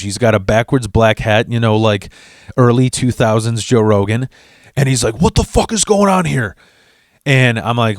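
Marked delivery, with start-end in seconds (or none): 5.2-6.5 higher, tough guy barking; angry demand. Retold or imitated. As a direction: come in hot and threatening. Huge ex-husband arriving, angry.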